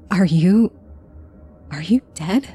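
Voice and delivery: halting, timid question with a false start; falter, then ask it in a small voice; a touch of frightened whimper; mild whimper. A narrator's reading. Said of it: "I whimpered, all sense of bravado lost".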